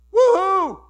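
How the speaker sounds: very high, very loud whoop; joyful whoop. Acted. Adam whooping with joy at the woman, loud and high. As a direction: let out a huge cheer of delight.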